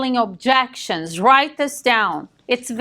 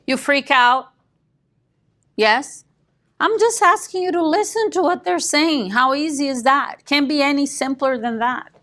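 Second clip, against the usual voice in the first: normal talking voice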